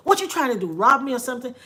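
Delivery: loud, high startled yell; retold shout of alarm. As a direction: blurt it out at the man who bumped you. Confronting stranger in store.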